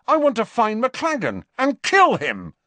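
high, loud furious outburst; angry. Acted. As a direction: spit it out in fury. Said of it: "said Harry angrily".

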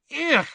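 high, loud disgusted groan; disgusted ugh. Acted. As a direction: recoil in disgust. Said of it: "said Lavender Brown again".

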